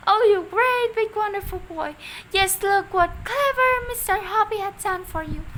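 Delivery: high, loud cry of delight. A narrator's reading. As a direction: gush over the tortoise. Mrs Silver gushing over tortoise.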